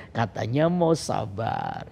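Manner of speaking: low, slow gentle chiding; God's reminder. Retold or imitated. God reminding the preacher as anger rises, low slow reminder. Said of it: "tapi Tuhan tuh ingatkan".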